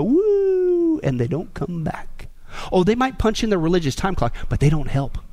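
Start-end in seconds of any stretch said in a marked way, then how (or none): 0.0-1.0 long, high whooshing "woo"; sustained high vocal whoosh; imitated "woo". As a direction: mimic someone sailing away on a high hoot. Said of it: "all of a sudden they go".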